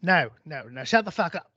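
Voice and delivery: annoyed Aussie telling-off; Australian killer. Acted. Australian killer interrupted mid-song, irritated. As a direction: cut her off in an Australian accent. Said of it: "I lost me Australian accent".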